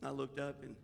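soft, low confessional telling; subdued confiding. Real, not acted. Pastor confiding his grief to the congregation, softly. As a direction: open up quietly to the folks.

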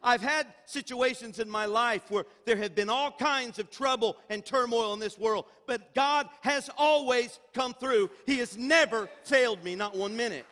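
loud, high preaching build. Acted. Pastor preaching loudly to his church about God's faithfulness. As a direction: preach it out to the congregation.

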